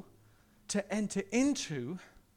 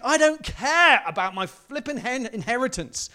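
higher and louder, dismissive; exasperated father; 4 semitones higher, 8 dB louder than usual. Retold or imitated. Father dismissing wealth, loud and impassioned. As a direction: brush the money aside loudly. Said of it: "He's like".

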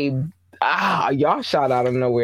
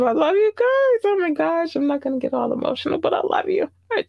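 put-on much higher, sweet baby-talk gushing; cute baby voice; 12 semitones higher than usual. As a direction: coo at the fans like they're puppies.

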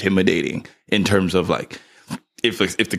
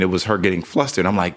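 usual speaking voice

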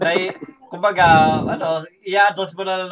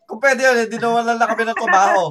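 higher, louder, quick rush of words; animated protest; 7 semitones higher, 4 dB louder than usual. Real, not acted. Telling co-workers they would be jobless, animated protest. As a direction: protest loudly, on the edge of laughter. Laughing protest that they'd lose jobs.

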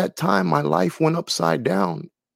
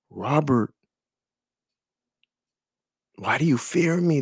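soft voice of Jesus. Retold or imitated. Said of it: "a soft voice".

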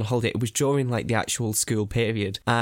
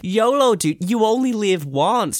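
higher, louder pushy yolo dude; mock German boy accent; 9 semitones higher, 4 dB louder, slower than usual. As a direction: egg him on, higher and louder. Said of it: "It's like".

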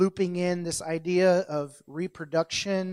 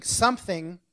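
ordinary talk

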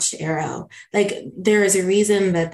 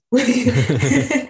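teary laugh; 5 semitones higher than usual. Real, not acted. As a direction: laugh off the tears. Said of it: "I'm getting a little teary".

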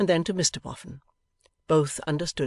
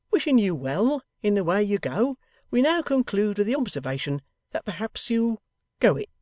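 lightly voiced blunt old man, a bit higher; Mr Boffin concluding; 6 semitones higher, 4 dB louder than usual. A narrator's reading. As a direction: kindly send them off. Boffin's closing speech.

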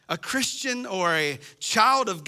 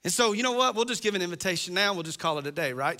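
ordinary talk

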